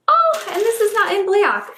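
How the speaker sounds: high-pitched exclamation of discovery; delighted surprise. Real, not acted. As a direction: exclaim it, pleasantly caught off guard. Surprised by eyeliner shade.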